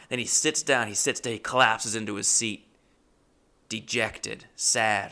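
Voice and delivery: ordinary delivery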